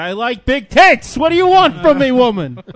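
loud hammed-up husband's outburst; comic, drawn-out holler; mock scream. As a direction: bellow it in mock exasperation. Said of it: "And then he screams out".

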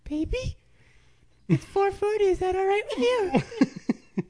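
very high, put-on partner voice; hammed-up sweet asking in falsetto; mocking imitation. Mocking the partner's sleepy question.

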